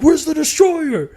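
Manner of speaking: put-on high, slow drunk demand; hammed-up drunk buddy yelling; drunk friend. Drunk friend hunting for his roommate, high and slow.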